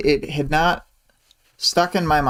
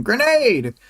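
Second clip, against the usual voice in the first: mock yell; 9 semitones higher than usual. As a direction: call it out like a half-voiced shout. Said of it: "yells to him".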